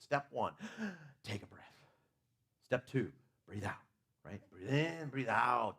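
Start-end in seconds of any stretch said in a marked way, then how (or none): none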